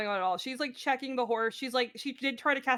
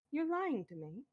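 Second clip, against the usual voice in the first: soft, stunned accusation; quiet hurt; 10 dB quieter than usual. Acted. Nat confronting a friend over a lie, quiet hurt.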